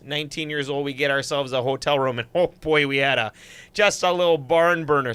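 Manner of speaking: chatty northern Wisconsin accent, close to Canadian; Superior Wisconsin accent. Shown as a demonstration. Host telling viewers about trips to Canada, Wisconsin accent. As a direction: spin the yarn in a folksy up-north Wisconsin accent. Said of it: "Superior, Wisconsin accent for you".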